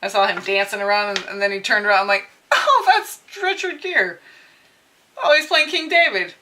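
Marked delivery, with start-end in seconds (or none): 2.5-6.3 high, laughing "oh" of recognition; amused mock astonishment; acted surprise. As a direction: re-enact a delighted realization, pitched up. Re-enacts own amused recognition.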